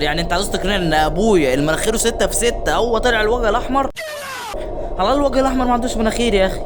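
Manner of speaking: fast, loud, high protest; indignant rant. Real, not acted. Gamer arguing with the story about the red face, fast loud protest. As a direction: rant fast at the absurd twist.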